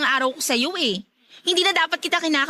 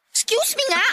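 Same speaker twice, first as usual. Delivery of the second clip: annoyed; 9 semitones higher than usual. Acted. Pushing past him annoyed.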